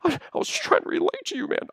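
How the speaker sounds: very high, defensive character voice; high-pitched little self-defence; high Albatross voice. Acted. Albatross trying to relate to upset Leo, high voice.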